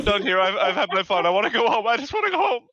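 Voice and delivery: put-on high, rising, pleading kid's voice; frightened kid. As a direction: beg to go home like a spooked child.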